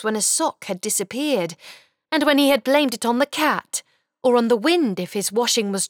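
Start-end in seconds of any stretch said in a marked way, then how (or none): none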